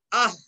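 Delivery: short আহ cut off on an h; breathed h ending. Shown as a demonstration. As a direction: end আহ in an audible puff. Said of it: "হ ধ্বনির মতো".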